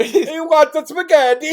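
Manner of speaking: put-on slow, high, loud hammy Italian accent; Italian Mario accent. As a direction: stretch it out like a cartoon Italian chef, with a thick Italian accent. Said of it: "we're gonna go full fucking Mario".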